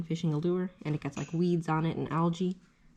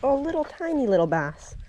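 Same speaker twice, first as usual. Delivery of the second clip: excited; 9 semitones higher, 5 dB louder than usual. Real, not acted. Catches a small bass.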